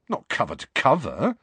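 put-on a touch defensive boy's voice; Harry guarding himself; defensively. A narrator's reading. Harry answers Hermione defensively. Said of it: "said Harry defensively".

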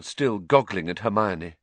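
usual speaking voice

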